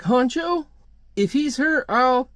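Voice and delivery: high-pitched, worked-up concern; acted upset friend starting a threat; alarmed threat. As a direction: pitch it way up in agitation. Reedy fears for hurt friend, threatens.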